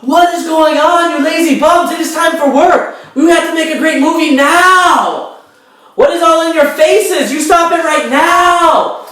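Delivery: high, loud full-throated scream; Sammy screaming. Acted. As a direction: bellow orders at the crew at the top of your lungs, very high. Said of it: "she is screaming at the top of her non-functional vampire lungs".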